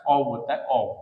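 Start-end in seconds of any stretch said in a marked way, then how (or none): 0.1-0.2 "ऑव" with a final v; correct pronunciation. Shown as a demonstration. Teacher answers his own question, "ऑव" with v. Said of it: "इसका सही उच्चारण क्या होता है?". 0.6-0.9 "ऑव" ending on a voiced v; correct pronunciation. Shown as a demonstration. Teacher repeats the right sound, "ऑव" with v. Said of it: "इसका सही उच्चारण क्या होता है?".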